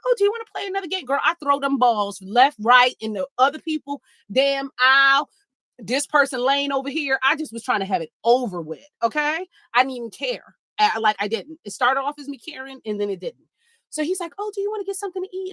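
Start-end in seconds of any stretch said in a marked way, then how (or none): 0.1-1.1 high, fast, chirpy date voice; eager date. Retold or imitated. Imitating her date asking for another game, high and fast. 14.4-15.5 high, fast, chirpy date voice; eager date. Retold or imitated. Imitating her date asking for another game, high and fast.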